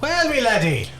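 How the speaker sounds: high, hammed-up Irish accent; mock Irish cop. Joking about old cops, mock Irish accent. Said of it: "they all had Irish accents".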